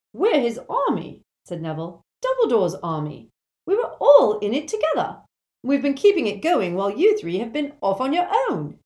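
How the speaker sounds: put-on loud, high, impassioned young rebel; Neville, earnest and insistent.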